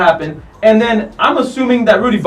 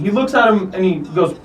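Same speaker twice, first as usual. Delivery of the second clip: ordinary delivery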